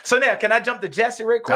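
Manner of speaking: loud, high-pitched eagerness; real rush of excitement; excited. Real, not acted. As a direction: burst in eagerly, pitch and volume up. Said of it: "'cause I'm getting excited".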